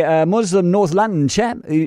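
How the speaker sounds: ordinary delivery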